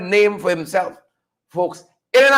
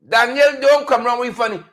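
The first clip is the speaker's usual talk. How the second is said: usual speaking voice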